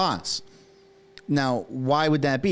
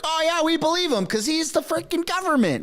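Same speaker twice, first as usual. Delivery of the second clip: mock high gullible voice, much higher; mocking the world. Vlogger parroting the public's blind trust, mock high voice. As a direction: sneer at the crowd by parroting them high and silly. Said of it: "And then the world is like".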